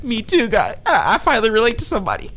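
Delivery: high-pitched imitated crying voice; hammed-up weepy confession; mock tearful relief. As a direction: whimper it as if moved to tears. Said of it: "He just starts crying".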